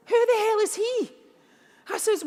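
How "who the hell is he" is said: much higher and louder, incredulous; who on earth is that; baffled disbelief. Retold or imitated. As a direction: mum hears an unknown name, high incredulous outburst. Mum's baffled reaction.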